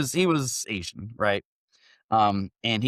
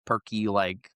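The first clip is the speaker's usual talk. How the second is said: usual speaking voice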